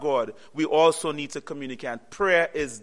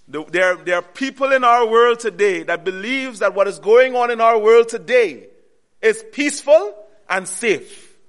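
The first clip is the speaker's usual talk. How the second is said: loud, higher preaching; preaching at full force; 4 semitones higher, 7 dB louder than usual. Acted. Preacher warning brothers and sisters, loud and urgent. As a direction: press the warning home.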